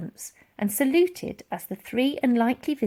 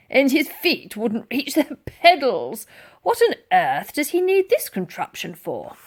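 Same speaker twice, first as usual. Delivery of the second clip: gruff sergeant major; 4 semitones higher, 4 dB louder than usual. A narrator's reading.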